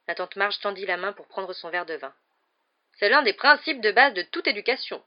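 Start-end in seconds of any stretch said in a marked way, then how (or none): 3.0-5.0 loud, high proclamation; booming, self-assured aunt; pontificating aunt. A narrator's reading. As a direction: declare it as a firm principle.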